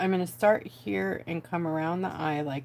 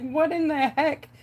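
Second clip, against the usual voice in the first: amazed disbelief; 8 semitones higher, 4 dB louder than usual. Retold or imitated. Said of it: "I was like".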